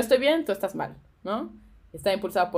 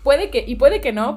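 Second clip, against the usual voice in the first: ordinary delivery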